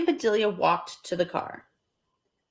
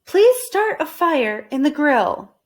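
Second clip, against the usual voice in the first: slow, louder request; Missus Rogers voiced; 7 semitones higher, 5 dB louder than usual. Missus Rogers asking for a fire, slow and polite. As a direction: ask politely. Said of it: "said Missus Rogers".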